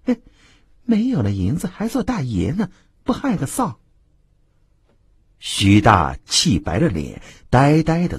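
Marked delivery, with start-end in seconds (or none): 0.1-3.8 high, soft contemptuous muttering; mild mock disdain; scornful grumble. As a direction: sneer under your breath. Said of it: "嘴里还嘟囔着".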